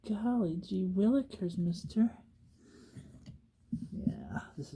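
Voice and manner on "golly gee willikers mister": put-on high, slow gee-whiz kid voice; wholesome 1950s kid. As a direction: sound like a wide-eyed fifties kid. Put-on old-timey exclamation.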